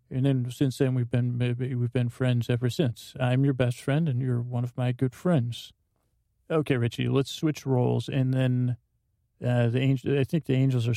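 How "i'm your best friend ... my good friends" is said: usual speaking voice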